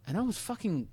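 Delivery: ordinary talk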